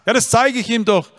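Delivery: high, loud defensive protest; insist, offended; father protesting he shows his love; indignant father. Retold or imitated.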